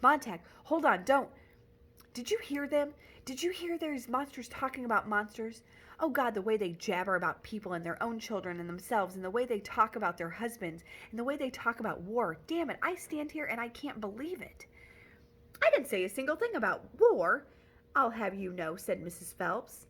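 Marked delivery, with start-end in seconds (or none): 0.0-14.7 outraged rant. Acted. Montag ranting at the women. 15.6-18.8 indignant protest. Acted. Mrs Phelps protesting indignantly.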